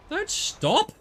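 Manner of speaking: sudden high yell at the car; shouting at the driver in the game. Real, not acted. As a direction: shout at him not to stop.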